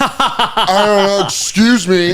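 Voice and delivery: high, drawn-out helpless plea; mock panic. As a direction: plead your way through a crowd, comically overwhelmed. Tripping, trying to get through crowd.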